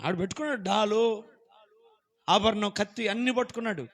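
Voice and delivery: ordinary talk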